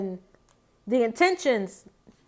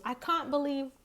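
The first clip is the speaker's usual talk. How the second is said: lightly imitated English accent, softer; mock English accent, quieter voice; 4 semitones higher, 7 dB quieter than usual. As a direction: put on a slight English accent, softly. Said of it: "in her accent, 'cause, you know, she's English".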